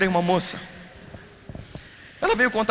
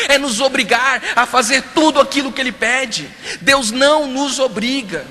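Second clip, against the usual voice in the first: forceful sermon voice, a bit louder; preaching; 6 semitones higher, 5 dB louder than usual. Real, not acted. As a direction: preach it out to the congregation. Pastor preaching to congregation. (pregação enfática)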